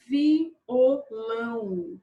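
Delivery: "Violão" very slowly, each syllable separate. Shown as a demonstration. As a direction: draw it out as vi... o... lão. Teacher splits word into syllables. ("Violão" bem devagar, sílaba por sílaba)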